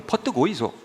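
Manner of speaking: Gyeongsang accent with swinging pitch; Gyeongsang dialect. Shown as a demonstration. Preacher showing regional welcomes to congregation, Gyeongsang accent. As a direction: welcome them like a Gyeongsang local. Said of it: "경상도".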